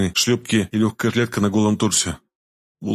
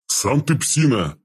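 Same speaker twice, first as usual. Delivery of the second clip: slow, drawn-out hurt retort; a touch of wheezy offence; offended, whistling voice; 3 semitones higher, much slower than usual. A narrator's reading. Big mutant guard insulted as a dog, offended. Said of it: "с обидой в свистящем голосе сказал Квас".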